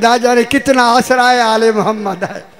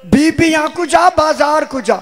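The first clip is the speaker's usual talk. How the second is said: wailing lament; 7 semitones higher than usual. Retold or imitated. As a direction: cry out in grief, beating the chest. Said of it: "अब मुंह पीट कर कहा". (ऊँची आवाज़ में रोता हुआ विलाप)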